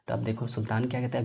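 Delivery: plain reading voice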